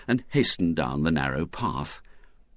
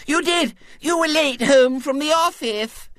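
put-on very high, louder little-girl outcry; crying out insistently; 5 dB louder than usual. A narrator's reading. Girl contradicting William's denial, shrill little cry. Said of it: "cried Violet Elizabeth".

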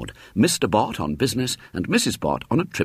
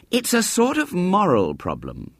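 high, slow, weighty pronouncement; solemn schoolboy Henry; 11 semitones higher than usual. A narrator's reading. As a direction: pronounce it like an earnest boy. Narrator voices solemn Henry.

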